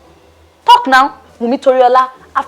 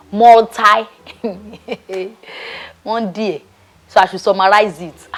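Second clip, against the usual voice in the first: ordinary delivery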